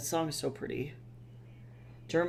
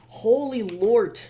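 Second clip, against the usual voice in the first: loud, high, slow "Holy lord"; loud amazed "Holy lord" at the huge order; stunned exclaim; 6 semitones higher, 10 dB louder, slower than usual. Real, not acted. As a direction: drag it out in disbelief. Reacting to big order.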